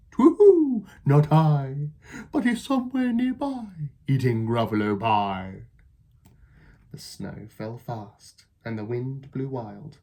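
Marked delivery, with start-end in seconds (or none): 0.1-5.6 high, loud hooting owl. Acted. Owl teasing the Gruffalo's child, loud and hooting. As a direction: hoot it out.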